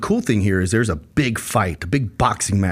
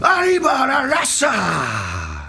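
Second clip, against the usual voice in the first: high, slow drawn-out announcer shout; fighting game announcer; 14 semitones higher, much slower than usual. Retold or imitated. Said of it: "doing it in the announcer voice".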